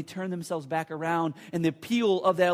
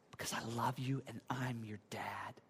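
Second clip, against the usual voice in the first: near-whispered, lower, tender reassurance; hushed reassurance; 8 semitones lower, 13 dB quieter than usual. Acted. Dad reassuring the child he is holding, tender whisper. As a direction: let it sink to a soft, loving whisper.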